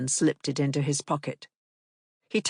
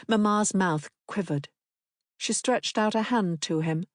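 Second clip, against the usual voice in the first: ordinary delivery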